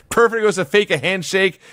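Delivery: normal talking voice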